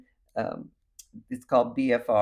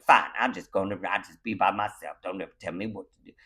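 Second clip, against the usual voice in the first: sulky retreat; 6 semitones lower, faster than usual. Retold or imitated.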